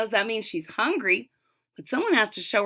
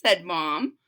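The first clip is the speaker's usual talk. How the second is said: ordinary delivery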